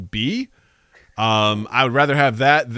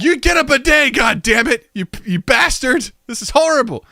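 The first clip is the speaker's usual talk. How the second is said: high, loud fed-up rant; cursing toilet; 10 semitones higher, 4 dB louder than usual. Pretend. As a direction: curse them out.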